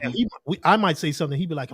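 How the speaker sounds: usual speaking voice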